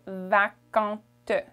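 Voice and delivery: vacante said slowly, the final T sounded, vacante; French feminine form. Shown as a demonstration. Teacher contrasting masculine and feminine vacant. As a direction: land clearly on the T of vacante. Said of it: "for masculine".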